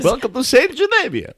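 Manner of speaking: high, loud mock tour announcer. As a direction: welcome them like a tourism ad.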